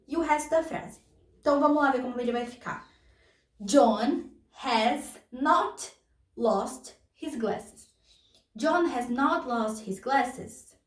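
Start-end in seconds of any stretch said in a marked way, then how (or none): 3.6-7.9 slow. Shown as a demonstration. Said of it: "demonstrating negative form".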